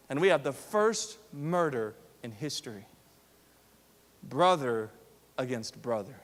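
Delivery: low, soft, grave preaching; hushed sermon. Acted. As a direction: drop the voice to a solemn hush. Hushed solemn stretch of sermon.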